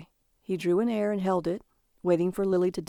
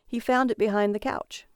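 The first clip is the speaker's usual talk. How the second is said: plain reading voice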